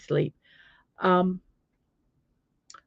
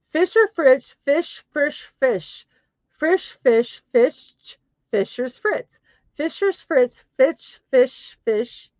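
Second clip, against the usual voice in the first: raised pitch, words set apart; careful tongue twister; 4 semitones higher, slower than usual. Shown as a demonstration. Nervous with other languages, trying the twister carefully. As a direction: feel out each foreign word separately.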